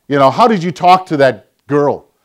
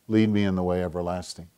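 hushed prayer; 8 semitones lower, 13 dB quieter than usual. Acted. Preacher reading the psalm's closing line, hushed. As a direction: let the verse settle quietly.

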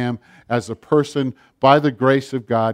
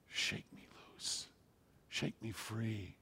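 whispered prayer; 4 semitones lower, 25 dB quieter, slower than usual. Retold or imitated. Friend's desperate prayer to God.

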